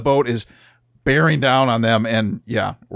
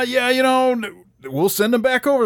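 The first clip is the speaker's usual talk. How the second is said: nervous bluff; 10 semitones higher than usual. Retold or imitated. Crew bluffing on the radio.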